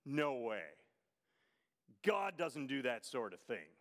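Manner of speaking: low, scoffing dismissal; dismissive general. Retold or imitated. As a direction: imitate a general brushing it off. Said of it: "a general in Israel's army".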